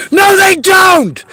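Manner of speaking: furious shout. Real, not acted. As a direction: bellow each word out slowly.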